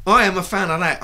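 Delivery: "I am" punched high and loud; emphatic retort. Retold or imitated. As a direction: loudly counter a refusal with a stressed "I am". Retold retort to a refusal.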